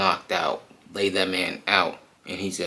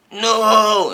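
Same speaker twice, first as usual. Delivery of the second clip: high, loud anguished scream; 10 dB louder than usual. Retold or imitated. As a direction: scream no in agony. Man transforming screams.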